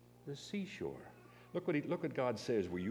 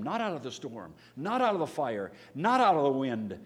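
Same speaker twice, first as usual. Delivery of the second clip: each "not out of" louder; building emphasis; 4 semitones higher, 8 dB louder than usual. Real, not acted. Preacher building a list to the congregation, rising loud emphasis. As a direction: hammer the repeated "not out of".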